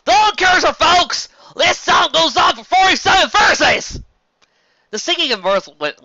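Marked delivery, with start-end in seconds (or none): 0.0-4.0 very loud, high shout; shout over the din. A narrator's reading. Applejack shouting over the laughing tavern, loud. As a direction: yell over the laughing crowd. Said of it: "to shout above the din".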